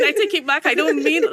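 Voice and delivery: high, loud comic pleading; frantic retraction. Retold or imitated. Woman retelling her bargaining with God, frantic and comic. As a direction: beg loudly to undo it, hammed-up.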